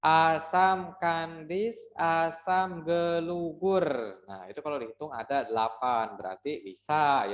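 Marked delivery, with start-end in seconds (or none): 0.0-3.9 "Asam kandis, asam gelugur" slow and higher, syllables apart; counting syllables. Shown as a demonstration. Teacher counting a pantun line for pupils. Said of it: "Coba nih kita hitung ya".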